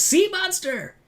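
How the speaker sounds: very high-pitched imitation of a cry; mock alarmed cry. Host as Luca betraying Alberto, mock alarmed cry.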